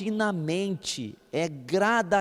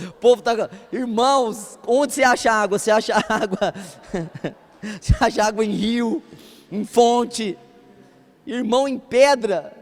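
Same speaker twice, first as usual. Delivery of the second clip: loud, incredulous preaching; loud rhetorical questions to the congregation; preaching at full force; 4 semitones higher, 6 dB louder than usual. Acted. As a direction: hammer the absurdity at the crowd. Preacher loudly mocking the idea.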